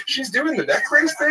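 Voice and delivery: high-pitched disbelieving squeal; scandalized high-pitched question; incredulous. Retold or imitated. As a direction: quote it in shrill, astonished disbelief. Acted shocked reaction to another comic.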